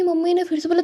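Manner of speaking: ordinary talk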